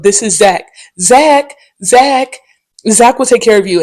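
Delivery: mock gushing intro. The regular introduces bartender Zach, gushing. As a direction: sell Zach like he's famous.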